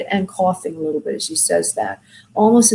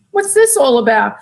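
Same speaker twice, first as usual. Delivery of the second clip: laughing, high mock surprise; puzzled laugh at the sudden change; bemused, giggling surprise; surprised; 5 semitones higher, 5 dB louder than usual. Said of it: "I'm like".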